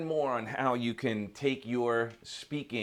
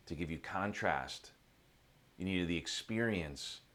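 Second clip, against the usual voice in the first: low, soft, patient explanation; calm sage; 5 semitones lower, 5 dB quieter than usual. Acted. Old sage explains the journey to the seeker, calm and patient. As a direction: explain gently in low, measured phrases.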